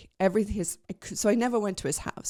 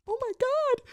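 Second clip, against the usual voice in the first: very high, breathless "Oh my God"; awed gasp. Retold or imitated. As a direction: squeak it out in wonder. Retelling finding the book.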